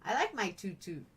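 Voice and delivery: ordinary delivery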